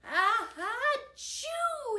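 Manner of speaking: high, loud hammed-up "achoo"; acted sneeze. A narrator's reading. Victor feels hot from pepper, acted sneeze. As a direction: build up and burst into a fake sneeze. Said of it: "he sneezed".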